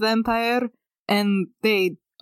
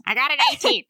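higher-pitched put-on character voice; 5 semitones higher, much faster than usual. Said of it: "no, that's the wrong voice".

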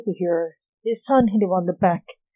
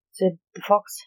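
usual speaking voice

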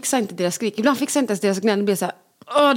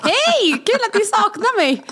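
sarcastic mom; 12 semitones higher, much slower than usual. Retold or imitated.

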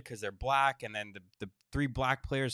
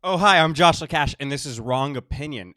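loud show intro; 7 dB louder than usual. Acted. Podcast intro announcement.

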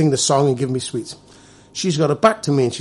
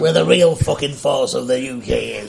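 mock Yorkshire accent, slow; broad put-on Yorkshire accent; 3 dB louder than usual. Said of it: "Easily the best Yorkshire accent".

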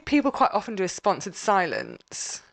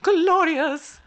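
high, theatrical "glorious"; hammed-up grandeur; grand diva; 8 semitones higher, 3 dB louder than usual. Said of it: "She's such an actress".

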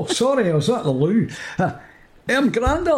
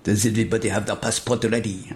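put-on Spanish accent; 7 semitones lower, 4 dB quieter than usual. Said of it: "says in his Spanish accent".

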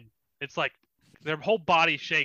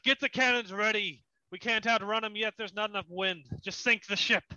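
raised-pitch captain giving battle orders; captain calling out; 5 semitones higher than usual. Acted. Captain orders his men to sink the ship, calling out. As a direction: call orders to the crew, pitched up. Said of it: "you hear him call out".

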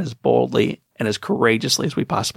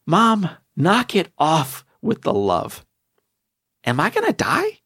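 put-on a child's voice, much higher, pleading; burned boy asking his mom if he'll die; scared boy; 8 semitones higher, slower than usual. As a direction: climb up into a frightened kid's question.

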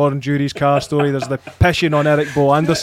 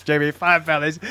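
robot voice. Retold or imitated. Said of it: "it's like".

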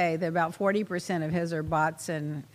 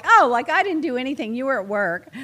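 louder sarcastic mock reply; mock annoyed retort; 3 semitones higher, 7 dB louder than usual. Host imitating a partner's sarcastic reply, louder. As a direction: snap back in mock annoyance.

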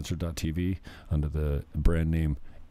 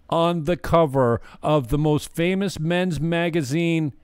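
normal talking voice

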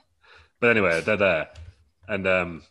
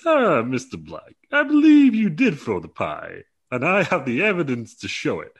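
high, slow, grand accusing voice; Keith Lee impression; 6 semitones higher, 6 dB louder, slower than usual. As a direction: accuse him with slow lofty gravity. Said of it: "that's how he talks".

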